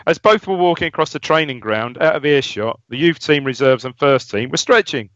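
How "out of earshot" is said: usual speaking voice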